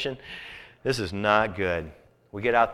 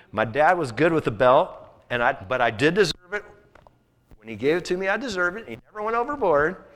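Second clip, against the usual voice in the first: emphatic preaching; 5 semitones higher, 7 dB louder than usual. Acted. Preacher builds to emphatic confession.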